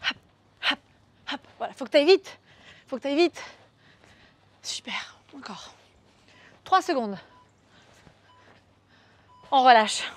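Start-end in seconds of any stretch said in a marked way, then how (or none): 4.6-5.7 whispered encouragement; soft unvoiced coaching whisper. Real, not acted. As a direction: hush it out under your breath. Whispered encouragement during exercise.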